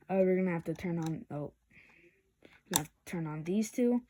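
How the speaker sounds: ordinary talk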